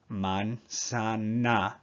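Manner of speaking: "Manzana" drawn out, with each A a long "ah"; very slow manzana. Shown as a demonstration. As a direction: drag out "Manzana" so every A is clear. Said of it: "Every A makes the same sound".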